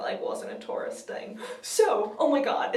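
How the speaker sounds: ordinary talk, nothing marked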